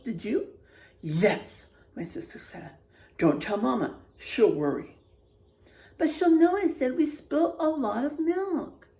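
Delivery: expressive storybook voice, quotes acted out; reading to children. A narrator's reading. Teacher reading a spooky picture book to the class, expressive. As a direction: read it like a spooky bedtime tale for kids.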